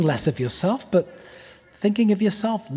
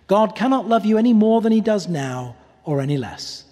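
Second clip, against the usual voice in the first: ordinary talk, nothing marked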